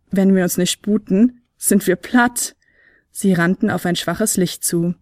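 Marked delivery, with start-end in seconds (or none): none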